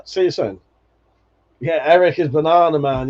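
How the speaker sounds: usual speaking voice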